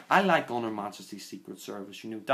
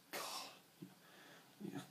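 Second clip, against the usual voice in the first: exasperated whisper; 19 dB quieter than usual. Real, not acted. Groaning at the bad line.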